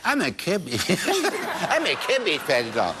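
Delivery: playful doll impression broken by laughter; laughing through it. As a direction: giggle while playing the doll. Host mimes doll, laughing.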